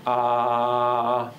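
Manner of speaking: a long, steady, level ah held out; sustained vowel. Shown as a demonstration. Lecturer demonstrating subjects holding a steady ah. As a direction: hold one flat ah like a subject in the booth. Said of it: "Subjects sit in our sound booth going".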